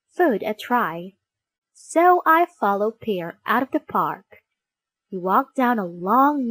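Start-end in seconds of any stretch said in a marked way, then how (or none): none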